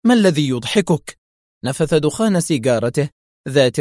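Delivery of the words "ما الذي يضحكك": higher, indignant question; stung and sharp. A narrator's reading. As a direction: demand it with an edge. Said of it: "فقالت في حدة".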